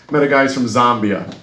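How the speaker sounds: ordinary delivery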